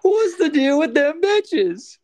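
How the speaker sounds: high, loud, slow comic bit; hack stand-up comic. Pretend. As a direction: belt it like a cheesy club comic.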